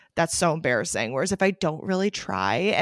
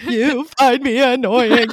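slow, higher whiny sob voice; imitating crying; 5 semitones higher, 6 dB louder, slower than usual. Crying at Chris on his lunch break, slow whiny wail. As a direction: drag it out like a teary accusation. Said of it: "standing there crying".

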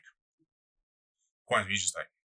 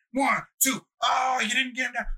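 excited referee count; 11 semitones higher than usual. Retold or imitated. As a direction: count the pin and protest like an invested referee. Acting out teacher refereeing a match.

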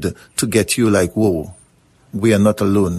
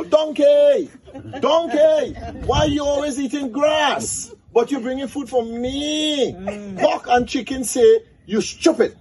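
indignant piglet. Acted. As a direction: scold the donkey in a high pig voice. Bigger piglet scolds donkey.

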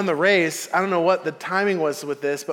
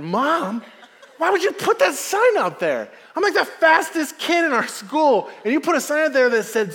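put-on high, loud, outraged kid complaining to mom; indignant kid; 6 semitones higher, 3 dB louder, slower than usual. Boy protesting to his mom about the sign, high and indignant. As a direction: protest like a wronged fast kid.

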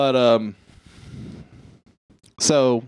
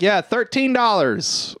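obnoxious bidder; 7 semitones higher, slower than usual. Retold or imitated. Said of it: "starts being a shithead at this auction".

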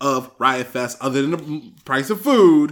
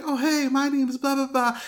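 much higher, bright chirpy intro; bubbly girl; 10 semitones higher than usual. Retold or imitated. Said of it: "And she was like".

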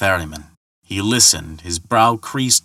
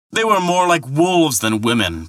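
protesting; 7 semitones higher than usual. A narrator's reading. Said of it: "protested Thor".

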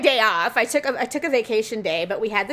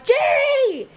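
high-pitched holler for someone in another room; retold yell; 13 semitones higher, 3 dB louder than usual. Wife yelling for her husband mid-meeting, retold holler. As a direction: re-enact yelling for your husband. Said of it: "me yelling at everyone".

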